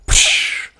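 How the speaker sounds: voiceless burst of spray; sharp "psh" of water; splash noise. A narrator's reading. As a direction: imitate a sudden splash breaking the surface.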